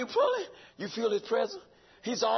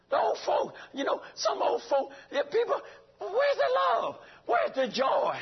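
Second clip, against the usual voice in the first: rising exhortation; 11 semitones higher than usual. Real, not acted. Preacher rebukes cold congregation.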